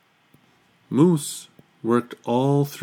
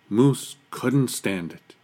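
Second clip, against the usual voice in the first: ordinary delivery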